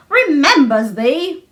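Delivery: put-on slow, indignant old gardener; a touch of offended disbelief, drawn out. A narrator's reading. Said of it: "said Weatherstaff indignantly".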